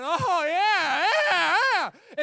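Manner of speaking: high, loud ecstatic yells; joyful yelling. Retold or imitated. Healed man leaping around the temple, shouting with joy. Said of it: "running around and yelling and screaming".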